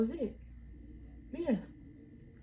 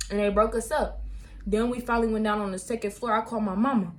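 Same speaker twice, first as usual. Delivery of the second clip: ordinary talk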